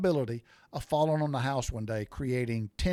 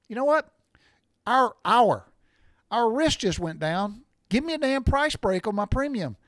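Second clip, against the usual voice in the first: higher and louder, fed-up; angry demand; 6 semitones higher, 5 dB louder than usual. Real, not acted. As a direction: demand that price break, fired up. Ranting about insurance premiums.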